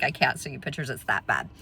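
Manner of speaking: low, hushed husband impression; grave verdict. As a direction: drop the pitch and volume for deadpan gravity. Husband's grim verdict, retold.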